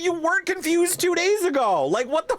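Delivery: high, incredulous mock outburst through laughter; laughing outraged retort. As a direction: squeal the retort in mock disbelief. Laughing replay of her retort.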